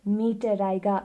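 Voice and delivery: usual speaking voice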